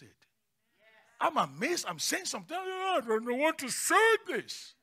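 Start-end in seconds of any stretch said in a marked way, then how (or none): 2.6-4.7 high, drawn-out mock panic; flustered exclamation. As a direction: wail it slowly, high-pitched and helpless. Acts out own panic at speaking.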